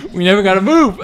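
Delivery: laughing through the words. Real, not acted. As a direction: giggle it out. Laughing about a bucket chair.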